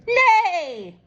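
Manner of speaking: high whinnying nay; Kitty neighing. A narrator's reading. Kitty insists she is a unicorn, high neighing. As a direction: neigh it like a pony. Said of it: "in case they didn't hear".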